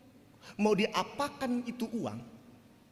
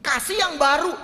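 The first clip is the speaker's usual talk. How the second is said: louder, pressing on yang; firm retort; 5 semitones higher, 7 dB louder than usual. Retold or imitated. Pastor to church members, a louder firm retort. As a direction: insist they give something new. Pastor scolding donors of secondhand goods.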